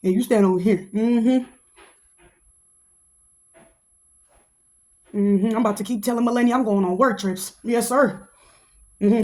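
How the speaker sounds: low, smooth, flirty Trump voice; imitating flirty Trump. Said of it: "He be like".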